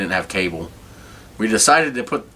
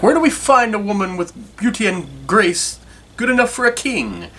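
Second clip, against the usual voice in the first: quoting the friend; 12 semitones higher than usual. Retold or imitated.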